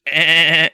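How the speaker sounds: loud mock goat bleat; imitated goat noise, loud and silly. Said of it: "sound like a goat".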